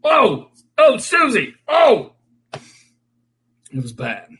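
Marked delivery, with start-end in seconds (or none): 0.0-2.1 loud high disgusted cry; disgusted shout. Retold or imitated. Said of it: "they're like".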